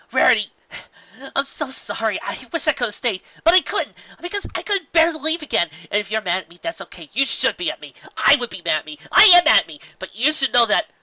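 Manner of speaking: blabbering apology. Acted. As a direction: pour out guilt to a friend. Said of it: "the blabbering Pegasus".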